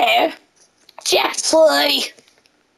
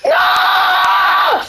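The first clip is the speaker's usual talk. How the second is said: very high, long "no"; dismayed wail. Real, not acted. Player crying out at the game, dismayed wail. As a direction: hold a high wail of dismay before it drops.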